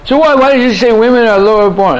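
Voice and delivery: high-pitched mock challenge; outraged heckler. Advocate challenging the teacher about the verse, high-pitched mock indignation. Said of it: "advocate started screaming".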